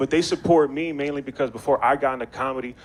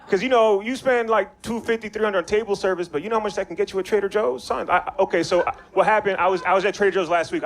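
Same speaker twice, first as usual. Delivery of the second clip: ordinary talk, nothing marked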